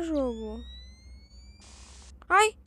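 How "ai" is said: high loud scared yelps; panicked cries. Real, not acted. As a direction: cry out in panic. Panicking at something in game. (gritinhos de pânico)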